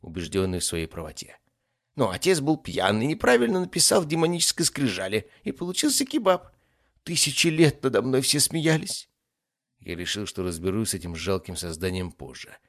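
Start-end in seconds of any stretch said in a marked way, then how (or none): 1.9-9.1 high, whining little demon voice; aggrieved ifrit. Acted. Demon explains the drunken naming mistake, aggrieved. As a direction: complain high, nursing an old hurt.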